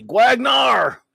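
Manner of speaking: high, loud drawn-out announcer call; big booming shout-out; rousing name shout. Real, not acted. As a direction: belt the name out like a hype announcer. Calling out a viewer's name.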